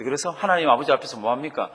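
ordinary talk, nothing marked

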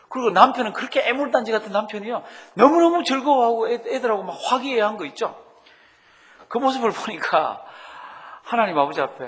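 excited storytelling. A narrator's reading. Pastor telling the congregation the happy ending, excited. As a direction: build the happy ending with rising delight.

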